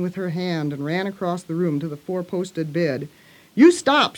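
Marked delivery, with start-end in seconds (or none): 3.5-4.2 high, loud, furious command; near-shout it in a girl's temper; almost shouting. A narrator's reading. Mary storming at screaming Colin, almost shouting. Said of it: "she almost shouted".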